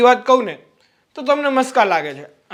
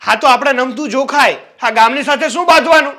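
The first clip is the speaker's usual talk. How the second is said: louder, insistent lecturing; loud arguing; 3 semitones higher, 5 dB louder than usual. Acted. Brother-in-law loudly lecturing his sister-in-law about the neighbours. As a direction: push your point hard over her. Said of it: "તો પણ ધીમે બોલો ને".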